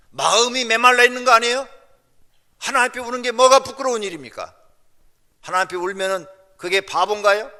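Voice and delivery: loud, rising rhetorical challenges; fervent preaching. Real, not acted. Preacher reproaching congregation for dry eyes before God, fervent.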